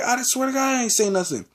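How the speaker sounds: very high-pitched oath of innocence; retold protest. Student swearing innocence to the teacher, high indignant-style protest. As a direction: insist on innocence, voice shooting up.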